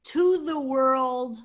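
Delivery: slow, with to said loudly; stress on to. Shown as a demonstration. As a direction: lean hard on to in to the world, slowly. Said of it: "Le is the Lamed in front of Le'olam".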